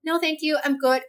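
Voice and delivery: flustered no thanks to a stranger; nervous polite refusal. Retold or imitated. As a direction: decline fast and politely. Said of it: "I had to be like".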